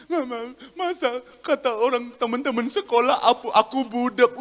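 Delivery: put-on whining child's tattle; small child complaining. Child telling mother about schoolmates, whining. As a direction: whine it out like a hurt little kid. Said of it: "anak kecil dia pulang".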